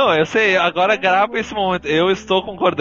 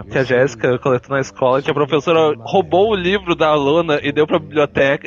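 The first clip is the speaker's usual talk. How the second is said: normal talking voice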